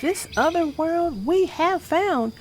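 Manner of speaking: put-on gentle rhyming read-aloud for kids; storybook reading. A narrator's reading. Vlogger reading a bedtime rhyme to kids, storybook voice. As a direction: read the picture book to young viewers. Reads picture book aloud.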